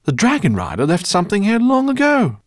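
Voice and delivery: high and loud with discovery; thrilled to share the find; excited. A narrator's reading. The professor showing the others the shrine, excited. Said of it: "cried the professor".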